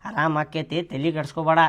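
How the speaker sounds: low, softened reassurance; calm and low, don't-worry tone; reassuring. Acted. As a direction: settle the worry in a quiet, low voice. Reassures about the sick woman.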